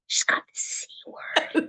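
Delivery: mock-secretive whisper of gossip. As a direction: gossip it under your breath.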